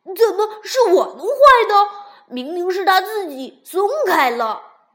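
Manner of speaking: high, loud indignant protest. A narrator's reading. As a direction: defend yourself, offended. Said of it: "嗓门儿也跟着高了起来".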